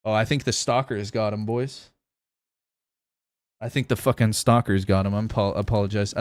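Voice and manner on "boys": normal talking voice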